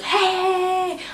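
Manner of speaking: high, drawn-out "hey" of alarm; long, high call across the room; cop calling out. Retold or imitated. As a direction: imitate a cop's high-pitched holler. Cops calling out to stop him.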